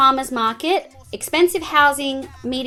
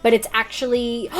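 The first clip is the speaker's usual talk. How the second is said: ordinary delivery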